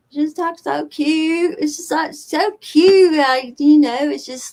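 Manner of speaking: high, slow, cutesy Southern drawl; hammed-up American Southern accent; imitated Southern accent. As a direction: imitate a cute Southern girl, loud and drawn-out. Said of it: "I love American Southern accents".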